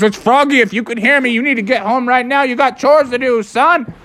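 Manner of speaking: high, fussy frog-dad voice, scolding at the end; a fussy father scolding his son over the phone. Acted. Father Frog phoning Mando, high fussy scolding voice.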